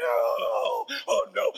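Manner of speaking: loud, hammed-up cry of alarm; mock scream. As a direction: play the kids panicking. Students screaming.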